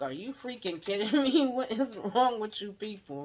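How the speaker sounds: mock outrage, laughing at the end; outraged. [name] outraged at Donald.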